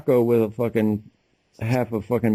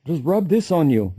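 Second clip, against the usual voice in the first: mock Chinese accent; 7 semitones higher than usual. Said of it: "That's a great accent".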